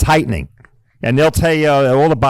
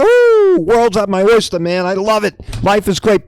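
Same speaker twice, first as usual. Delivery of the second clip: much higher, breezy happy-go-lucky driver; carefree joy; 6 semitones higher than usual. Pretend. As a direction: play a driver without a care in the world. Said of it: "and you're driving along, and".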